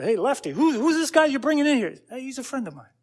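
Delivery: high-pitched mock Italian mobster accent. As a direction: play an Italian mobster greeting his crew. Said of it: "That's my Italian accent".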